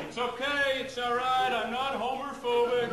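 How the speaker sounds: high, loud flustered reassurance; dad calmly-panicked, too loud. Retold or imitated. Dad to the groping guy at the party, high and loud. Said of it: "He's like".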